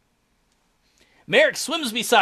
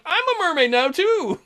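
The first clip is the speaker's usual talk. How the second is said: much higher giddy squeal; giddy mermaid; 8 semitones higher than usual. Retold or imitated. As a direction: squeal with glee. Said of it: "I'm like".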